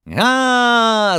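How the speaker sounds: high, loud cry; shout. A narrator's reading. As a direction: cry out in delight. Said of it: "закричал хозяин". (громкий крик)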